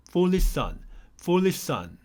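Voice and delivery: "foolish son" with one shared sh-s sound, "fuli-san"; linked pronunciation. Shown as a demonstration. 영어 연음 발음 시범: foolish son. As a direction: run "foolish son" together with no gap. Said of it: "이런 식으로 연결해서 발음해 주세요".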